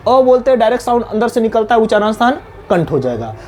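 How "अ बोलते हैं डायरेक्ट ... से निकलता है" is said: ordinary talk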